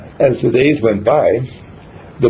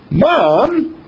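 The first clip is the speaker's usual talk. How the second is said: much higher, surprised yelp; astonished cry; 11 semitones higher than usual. Retold or imitated. Son amazed at his mother, crying out high. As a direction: call out amazed.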